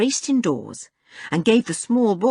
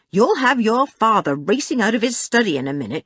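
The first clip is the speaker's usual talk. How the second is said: put-on raised-pitch warning to noisy children; exasperated scolding; 5 semitones higher, 4 dB louder than usual. A narrator's reading. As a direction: scold them with some urgency.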